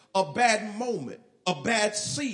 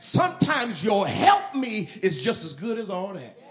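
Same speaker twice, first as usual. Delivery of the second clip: louder, rising sermon climax; preaching at full force; 3 semitones higher, 7 dB louder than usual. Acted. Pastor to congregation, building to a loud preaching climax. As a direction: drive it home to the congregation.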